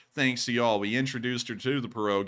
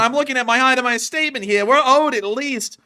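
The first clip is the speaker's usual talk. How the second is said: high, loud, slowing into incredulous yelling; mock outrage; 12 semitones higher, 11 dB louder than usual. Streamer disputing his fake CIA paycheck, mock outrage. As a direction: play up indignant disbelief, voice way up.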